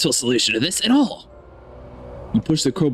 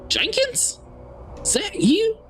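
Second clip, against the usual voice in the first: much higher, thin, nervous voice of a hiding man; frightened stranger; 4 dB quieter, slower than usual. Acted. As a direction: peek out scared from behind the door. Scared man inside locked train.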